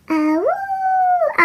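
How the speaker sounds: high singing howl; playful high dog howl. A narrator's reading. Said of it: "Olive sang along".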